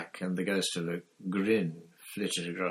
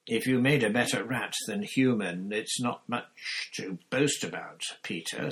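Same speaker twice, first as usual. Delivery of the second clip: harshly; 3 semitones higher, 6 dB louder than usual. Acted.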